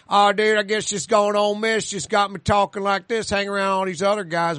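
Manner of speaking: put-on country guy accent. College kid explains his changed speech, mock country accent. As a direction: imitate a country boy explaining himself. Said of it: "He starts talking like a country guy".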